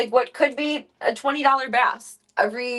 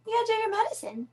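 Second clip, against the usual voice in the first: pet talk; 12 semitones higher, faster than usual. Real, not acted. Coaxing her cat to take medicine, high and fast. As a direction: coax it up high and quick. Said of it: "repeated higher".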